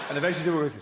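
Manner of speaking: ordinary delivery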